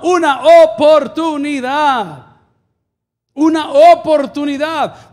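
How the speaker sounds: high, loud proclamation, building to stretched phrases; full-force preaching. Real, not acted. Pastor telling what she found in Christo, full-force preaching.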